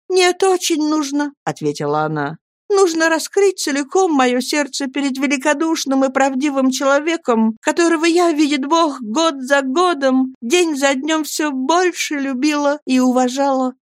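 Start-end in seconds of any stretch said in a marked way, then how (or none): 0.1-1.3 put-on a higher, earnest young wife's voice; Annie pleading. Young wife begging her friends to speak, pleading. 2.7-13.7 put-on a higher, earnest young wife's voice; Annie pleading. Young wife begging her friends to speak, pleading.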